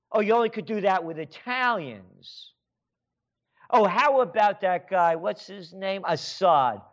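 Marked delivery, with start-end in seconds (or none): none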